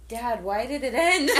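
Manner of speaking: high, loud plea through a laugh; dismayed outcry. Retold or imitated. Daughter protesting to Dad as the film ends, dismayed.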